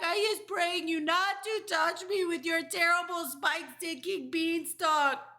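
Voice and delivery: high, loud, relentless pleading; terrified scream. A narrator's reading. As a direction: giant begging for mercy at full volume. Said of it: "screamed the flesh lump eater".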